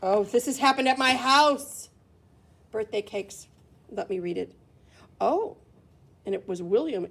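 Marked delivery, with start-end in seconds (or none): none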